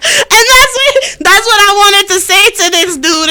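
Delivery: high, loud, still cracking up; talking through laughter. Real, not acted. Host recounting an unsent reply, laughing through it. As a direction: push the words out through laughter.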